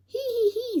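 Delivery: a high tickled laugh; chuckle. Acted. Man laughing again at his stubborn pig, high chuckle. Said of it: "says Mister Watson again".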